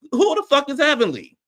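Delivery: high, loud indignant demand; furious outburst. Real, not acted. Reviewer fuming at Heavenly's attitude, high and loud. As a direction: blow up at the name Heavenly. Ranting about Heavenly on show.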